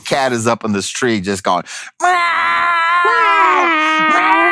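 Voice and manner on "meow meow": very high, drawn-out cat yowl; screaming cat meow. Retold or imitated. Cat stuck up the tree, imitated screaming yowl. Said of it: "just screaming his head off".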